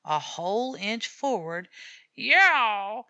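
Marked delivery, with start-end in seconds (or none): 2.1-3.1 loud sharp yowl; cat yowl. A narrator's reading. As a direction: yowl a warning. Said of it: "And he went another inch".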